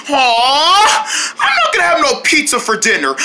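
high, wailing complaint; angry whine. Acted. No pizza for dinner, angry wail. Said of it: "I'm so angry".

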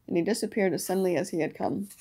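ordinary delivery

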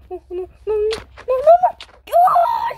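whimpering to shouting panic; panicking gamer. Real, not acted. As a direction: whine then yell in panic. Losing round, whimpering panic.

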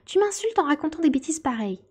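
high-pitched indignant scolding; offended. A narrator's reading. Offended mouse scolds Alice. (réprimande vexée, voix aiguë)

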